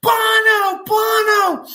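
high, shrieked fan chant of the name, each call held; imitating screaming fans. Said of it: "chicks in the church are screaming".

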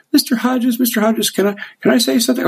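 put-on young host breaks into the guest's monologue, rushed and high; interrupting host. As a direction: cut in quickly and high, imitating her. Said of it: "then Nancy goes".

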